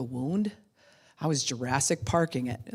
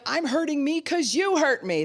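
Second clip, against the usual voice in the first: much higher, petulant mock spite; mock spiteful whine; 12 semitones higher, 5 dB louder than usual. Hurt person spiting everyone by hurting himself, high mock whine. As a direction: whine it like a sulking child. Mocking self-harming spite.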